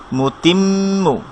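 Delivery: slow মুতিম্মু, mm held in the nose; মুতিম্মু with long nasal mm; held ghunna. Shown as a demonstration. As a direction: hum the doubled m of মুতিম্মু slowly. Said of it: "এখানে আমরা কেন একটু ধরছি".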